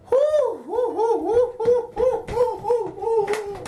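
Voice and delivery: whooping high with laughter; high laughing whoops. Real, not acted. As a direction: let out high laughing whoos. High whoops, laughing, steadying herself.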